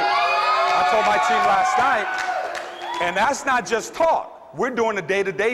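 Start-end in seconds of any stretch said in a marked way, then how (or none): 0.0-3.1 slow shout. Real, not acted. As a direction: hammer the line slowly to the crowd. Repeated, slower, shouted.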